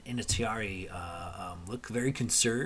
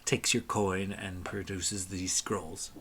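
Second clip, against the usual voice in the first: ordinary delivery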